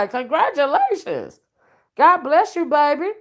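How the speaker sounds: excited blessing. Real, not acted. Congratulating viewer on new baby.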